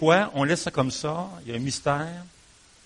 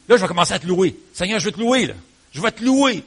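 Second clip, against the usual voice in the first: louder, higher, rising jubilation; joyful praise; 7 semitones higher, 8 dB louder than usual. Acted. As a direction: burst out praising the Lord. Voicing David's praise. (louange joyeuse et éclatante)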